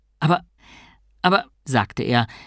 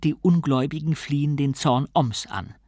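usual speaking voice

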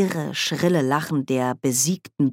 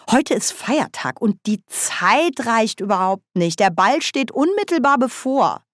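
higher, insistent character voice; Nanny Ogg, drängend; 7 semitones higher, 3 dB louder than usual. A narrator's reading. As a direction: protest that time is running out. (hoch und drängend einwendend)

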